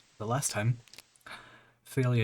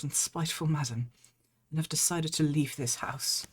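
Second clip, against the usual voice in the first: higher, gentle maid reading; maid character voice; 6 semitones higher than usual. Acted. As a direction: voice the weary maid, raised pitch. Reads maid's game text.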